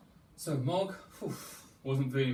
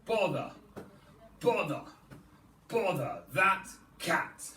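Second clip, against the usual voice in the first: cross parents; 2 semitones higher than usual. Acted. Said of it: "they said".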